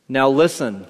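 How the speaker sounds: usual speaking voice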